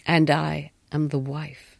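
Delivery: low, slow declaration; slow low wife. A narrator's reading. The wife revealing herself to the governess, low and slow. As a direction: lay it down slowly, like a blow.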